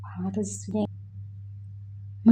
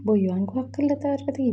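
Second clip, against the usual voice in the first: ordinary delivery, nothing marked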